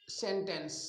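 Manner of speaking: normal talking voice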